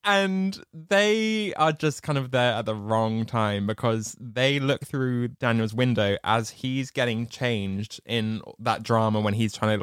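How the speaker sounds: ordinary talk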